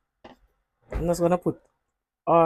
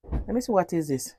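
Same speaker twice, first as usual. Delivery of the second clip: ordinary delivery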